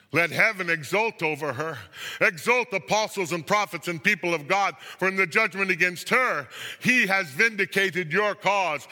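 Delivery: loud, high call to rejoice; ringing, exultant summons; exultant proclamation. A narrator's reading. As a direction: proclaim it triumphantly to a crowd.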